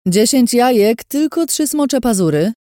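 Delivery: put-on old woman hawking eggs; market crier. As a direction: shout wares across the stalls. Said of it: "krzyknęła".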